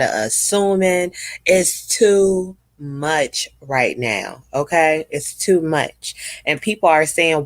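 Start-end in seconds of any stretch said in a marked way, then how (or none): 1.5-4.4 each word drawn out slowly to press the point; slow emphasis. Real, not acted. To her viewers, insisting slowly that it's too much. 5.1-6.4 slow emphasis. Real, not acted. To her viewers, a slow firm repeat.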